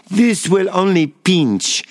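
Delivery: slow, high chant-like delivery; incanting. A narrator's reading. Needle lady incanting over the patient's arm, slow and high. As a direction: intone it like a spell, high and drawn out. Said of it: "she incants over the fresh flesh".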